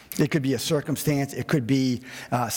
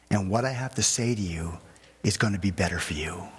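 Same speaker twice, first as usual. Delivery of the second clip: tender voice of God; 4 semitones lower than usual. Acted. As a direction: speak like a father to a child. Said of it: "he's saying".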